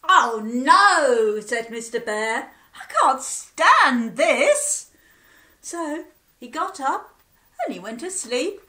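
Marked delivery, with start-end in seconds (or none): 0.1-1.4 loud, fed-up groan; exasperated groan. Acted. Sleepless bear exasperated. 2.8-4.9 loud, slow and fed-up; fed-up bear. Acted. Sleepless bear exasperated.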